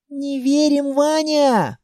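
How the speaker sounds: put-on high-pitched indignant little girl; indignant child. A narrator's reading. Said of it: "возмутилось дитя". (возмущённый детский голос)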